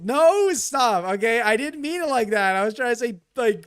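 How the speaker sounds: loud protest. Real, not acted. Protesting chat accusation.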